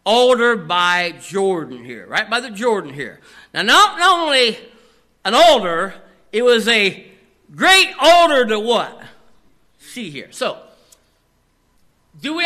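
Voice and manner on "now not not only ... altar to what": rising, louder preacher's build; preaching emphasis. Real, not acted. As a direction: drive the question home to the congregation.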